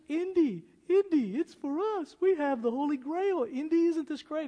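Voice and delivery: lightly voiced eager woman begging Indy; Elsa pleading. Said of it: "She says".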